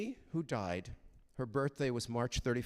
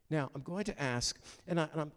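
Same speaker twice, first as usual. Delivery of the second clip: ordinary talk, nothing marked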